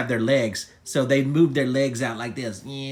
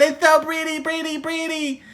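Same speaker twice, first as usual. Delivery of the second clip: high-pitched gushing admiration; delighted gushing; 12 semitones higher than usual. Acted. Yodi admiring the butterfly, high gushing delight. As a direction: gush in a high little voice.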